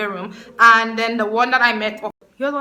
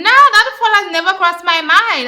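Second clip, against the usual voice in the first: incredulous denial; 8 semitones higher, 6 dB louder than usual. Retold or imitated. Retorts to his accusation.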